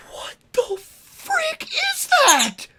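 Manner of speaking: very high, drawn-out stunned exclamation; acted astonishment. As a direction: stretch it out high in mock disbelief. Acting out stunned dragon sighting.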